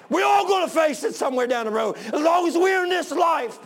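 high, loud shouted sermon; impassioned pulpit shout; full-force preaching. Real, not acted. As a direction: cry it out to the congregation in long ringing phrases.

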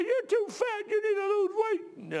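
very high, slow scolding; high, drawn-out mock scolding; scolding sister impression. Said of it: "she'll rip my face off".

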